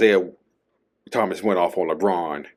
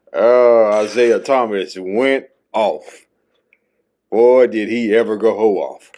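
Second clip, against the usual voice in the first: hype opener; 2 semitones higher, 6 dB louder than usual. Acted.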